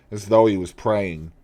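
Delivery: ordinary delivery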